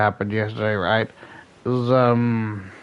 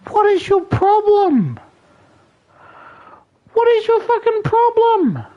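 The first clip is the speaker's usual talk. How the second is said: furious yell; 4 dB louder than usual. Retold or imitated. To rude new person over cold, furious high yell. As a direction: scream it at them, fed up. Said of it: "So I'm like".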